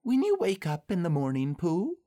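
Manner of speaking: high little-animal voice; Piglet. A narrator's reading. Piglet walking home with Pooh, high small voice. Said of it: "said Piglet at last".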